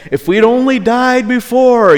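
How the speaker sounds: whiny complainer. Retold or imitated. Israelites grumbling, voiced.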